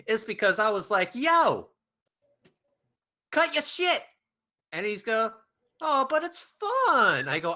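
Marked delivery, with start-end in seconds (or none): 1.2-4.0 high, emphatic call-out with a long pause; retold scolding. Retelling how you told your friend to stop, scolding. 5.8-7.3 high, drawn-out imitated protest; mock pleading. Imitating a friend protesting he should continue, mock pleading.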